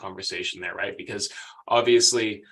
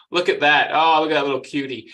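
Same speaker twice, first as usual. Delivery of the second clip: louder, bright burst of delight at a cute photo; delighted coo; 4 semitones higher, 7 dB louder than usual. Real, not acted. Guest spots a cute photo on his slide, louder delighted coo. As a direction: coo over the little cutie, louder and higher.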